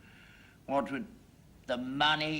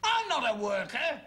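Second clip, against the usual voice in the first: high, indignant outburst; indignant retort; 8 semitones higher than usual. Acted. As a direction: throw the accusation back, affronted. Indignant retort to traitor accusation.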